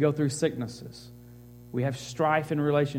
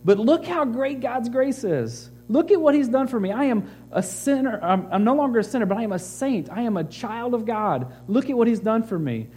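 higher and louder, building enthusiasm; excited reading; 8 semitones higher, 3 dB louder than usual. Retold or imitated. Pastor reading Paul's testimony to the church, excited and higher. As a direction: read Paul's words like good news you can't contain. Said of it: "with excitement and enthusiasm".

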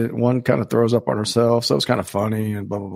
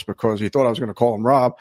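normal talking voice

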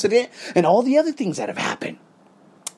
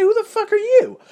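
much higher, incredulous; astonished outburst. Retold or imitated. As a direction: squeal out in disbelief. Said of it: "I said".

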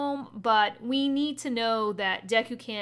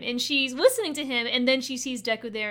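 usual speaking voice

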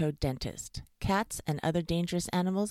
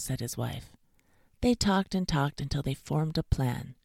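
usual speaking voice

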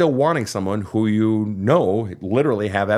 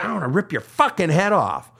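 mock rage; 7 semitones higher than usual. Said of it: "It's like".

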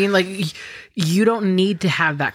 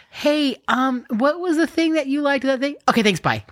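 much higher, breezy, only-calls-when-needed friend; careless caller; 11 semitones higher than usual. Retold or imitated. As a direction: sound airy and self-centred. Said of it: "a friend who calls you and is like".

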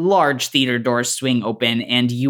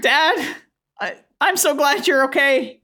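put-on high, earnest kid voice; relieved teenager; 13 semitones higher than usual. Tyler hugs his dad, relieved and high-pitched.

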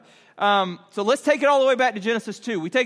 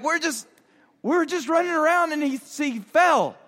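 put-on higher-pitched kid explaining himself; defensive kids; 6 semitones higher than usual. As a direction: imitate flustered children making an excuse. Kids explain themselves to dad.

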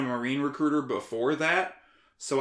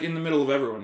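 normal talking voice

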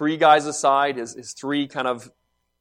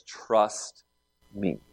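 softer "Trust me", eye to eye; quiet intimate; 7 semitones lower, 5 dB quieter than usual. Retold or imitated. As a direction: say it softly and steadily. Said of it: "he looks him in the eye, says".

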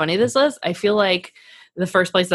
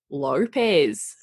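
Australian accent; 2 semitones lower than usual. Shown as a demonstration. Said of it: "there's so many sounds in her last name".

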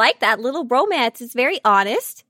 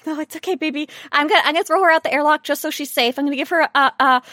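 fast, hammed-up soothing that climbs higher and louder; mock reassuring; 6 semitones higher, 4 dB louder than usual. Player hamming up a tender promise to Nadia, mock soothing. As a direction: sweetly promise safety, building into a yelp. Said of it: "I'm like".

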